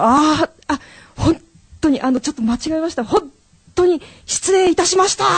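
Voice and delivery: high, loud, flustered apology; re-enacted over-polite trainee salesman, pitched high; rookie salesman voice. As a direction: apologise at full volume like a new salesman. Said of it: "新人営業マンみたいな声を".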